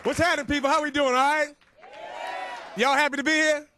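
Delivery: loud, high call to the room; crowd hype. Real, not acted. Comic greeting the crowd at the start, loud hype. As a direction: get the crowd going.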